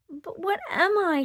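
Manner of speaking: high, pleading cry; a lost little cry, pitched high. Acted. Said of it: "she cried".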